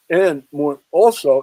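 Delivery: ordinary delivery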